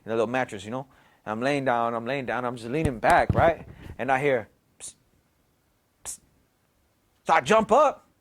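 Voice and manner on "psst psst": hushed, hissed psst; quiet psst to get attention; whispered psst. Retold or imitated. A voice calls him in the dark, whispered psst. Voice he hears while lying down.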